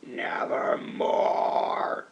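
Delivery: low, slow, breathy whispered croak; raven's reply. Acted. Raven answering the poet with a low, slow whispered croak. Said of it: "Quoth the raven".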